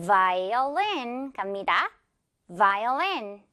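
"violin" drawn out with vibrating v; buzzing V. Shown as a demonstration. Teacher leading repetition, slow buzzing V. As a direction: buzz into "violin" like a phone. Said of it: "핸드폰 진동 여기다 주면서".

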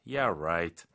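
low, drawn-out sarcasm; skeptical, stretched "right"; sarcastic. Shown as a demonstration. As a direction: drop the pitch and doubt them. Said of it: "약간 비꼬는 톤이죠".

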